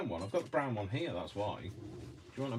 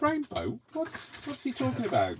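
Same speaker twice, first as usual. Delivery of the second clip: much higher, squeaky confused voice; mock confused viewer. Crafter mocking puzzled viewers, high squeak.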